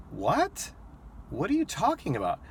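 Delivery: baffled comeback to an out-of-line question; incredulous. Retold or imitated. Driver to drunk passenger's crude question, incredulous.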